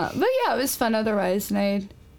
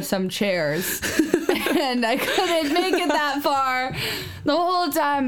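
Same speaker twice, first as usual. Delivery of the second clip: laughing; 6 semitones higher than usual. Real, not acted. Laughing through fainting story.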